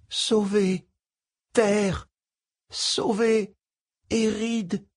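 high, far-off trembling alien voice; a weak, trembling plea. Acted. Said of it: "d'une voix tremblotante".

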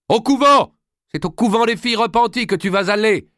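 very high, furious yell; shouted through a door. A narrator's reading. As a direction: hurl the threat through a locked door. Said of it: "lui lança-t-elle à travers la porte".

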